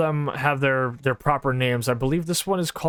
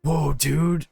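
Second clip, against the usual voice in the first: goofy warden. Retold or imitated. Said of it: "Just walking around going".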